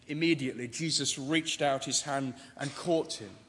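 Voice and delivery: usual speaking voice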